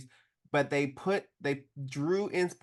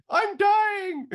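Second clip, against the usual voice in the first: very high, loud, hammed-up despair; mock dramatic wail; 6 dB louder than usual. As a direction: wail like an overwrought fan. Imitating fans' reactions to the episode.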